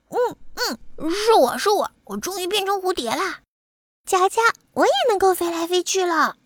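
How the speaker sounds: high, gleeful butterfly voice; jubilant cheering. A narrator's reading. New butterfly celebrates with his friend, jubilant. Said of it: "里卡多欢呼着说".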